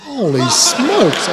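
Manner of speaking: slow, high, loud holy smokes; comic alarm, drawn out; mock panic. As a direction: let the panic out slowly. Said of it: "I'm thinking".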